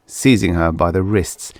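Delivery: plain reading voice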